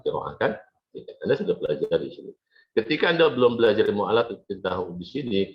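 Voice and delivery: ordinary talk, nothing marked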